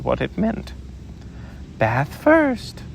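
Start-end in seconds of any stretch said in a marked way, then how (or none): 1.8-2.8 higher, bright brisk mother; cheerful Kanga. Acted. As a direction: announce it cheerily. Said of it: "in a cheerful voice".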